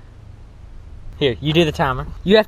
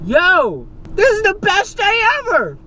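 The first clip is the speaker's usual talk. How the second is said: high, thrilled shout of joy; over the moon about it; excited; 13 semitones higher, 4 dB louder, slower than usual. Real, not acted. Excited about five chicken pieces.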